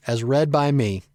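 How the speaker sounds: usual speaking voice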